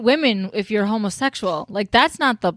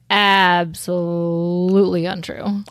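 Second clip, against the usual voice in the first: slow, deliberate official denial; stern cop voice; much slower than usual. Retold or imitated. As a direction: lightly voice a flat police officer. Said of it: "police officers are like".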